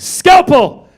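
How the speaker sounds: sharp high loud call for the scalpel; barked surgeon call. Acted. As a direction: snap it out like a surgeon mid-operation. Surgeon calling for instrument.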